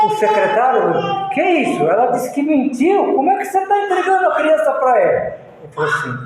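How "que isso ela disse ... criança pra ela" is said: high, fast incredulous protest; secretary objecting to the king, raised and quick; lightly voiced shocked secretary; indignant disbelief.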